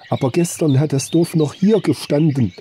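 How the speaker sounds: high-pitched, agitated protest; distraught cry. A narrator's reading. Arnold protests to the disbelieving hunter, distraught cry. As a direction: insist in alarm, barely in control of the voice. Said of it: "seiner Stimme selber kaum mehr mächtig".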